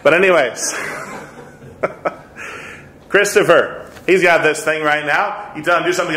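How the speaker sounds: usual speaking voice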